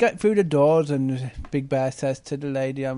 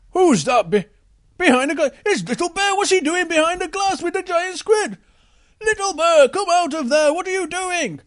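high, loud alarmed calling; alarmed Big Bear; 11 semitones higher, 4 dB louder than usual. Acted. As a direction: call out in shock, pitched up. Big Bear sees Little Bear.

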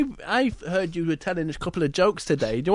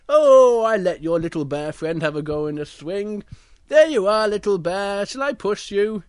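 obliging polar bear; 3 semitones higher, 7 dB louder than usual. Acted. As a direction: offer the cub a push. Polar bear gives up the swing.

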